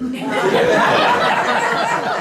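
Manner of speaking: high breathy laugh bursting out; real laughter. Real, not acted. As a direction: laugh out loud at the punchline. Laughing at own story.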